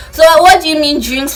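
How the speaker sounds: loud, high indignant question; outraged disbelief. Retold or imitated. Birthday girl questioning the planner, outraged disbelief.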